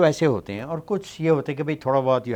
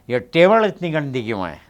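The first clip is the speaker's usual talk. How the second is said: put-on ये table इतनी गंदी क्यों है with a light South Indian accent, louder; 3 semitones higher, 7 dB louder than usual. Imitating Hema Malini's South Indian accent, loud and scolding. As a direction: mimic a South Indian accent scolding over the dirty table. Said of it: "तो उन्होंने अपने accent से कहा".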